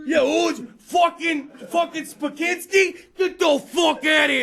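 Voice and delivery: loud, high, dragged-out shouted refusal; furious coach. Retold or imitated. Hockey coach yelling back at a parent from the bench.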